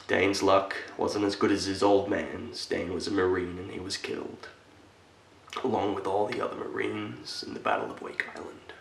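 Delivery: soft, solemn war recollection; hushed telling of a death in battle; somber. Acted. Solemnly telling boy of grandfather's death.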